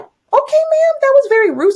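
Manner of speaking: high-pitched mock affront; indignant retort. Acted indignant reply to rude teacher.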